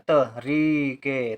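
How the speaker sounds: तरीके very slowly, त cut off from रीके; short त, then long री and के; broken into syllables. Shown as a demonstration. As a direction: draw out तरीके piece by piece. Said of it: "अलग से बोला जा रहा है".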